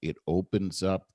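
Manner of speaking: low, soft, calming delivery; late-night DJ voice. Shown as a demonstration. Showing how to calm someone highly emotional, late-night DJ voice. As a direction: let each phrase trail gently downwards. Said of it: "I was talking like that on purpose".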